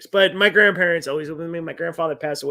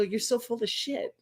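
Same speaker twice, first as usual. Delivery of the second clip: laughing; 5 semitones higher, 7 dB quieter than usual. Real, not acted. Laughing at grandma.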